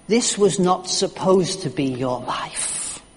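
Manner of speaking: ordinary talk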